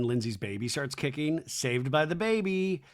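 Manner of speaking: ordinary talk, nothing marked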